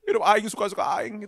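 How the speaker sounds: high, loud, brash street-preacher imitation; hammed-up slangy preacher shout; imitating friend's preaching. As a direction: belt it out the way your friend preaches. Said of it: "Cara dia ngomong kan kayak gitu kan".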